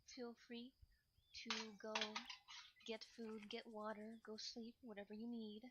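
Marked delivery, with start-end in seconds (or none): none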